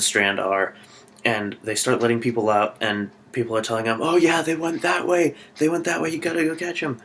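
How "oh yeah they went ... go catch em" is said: high-pitched helpful tipsters; voiced bystanders. People telling the group where they went, in high urgent voices. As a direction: put on higher voices, urging them onward.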